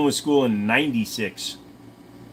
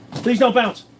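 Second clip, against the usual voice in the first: high, loud plea; squeaky urgent please; pleading; 9 semitones higher, 5 dB louder than usual. Real, not acted. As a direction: beg it not to bounce, pitched up. Begging an item not to bounce.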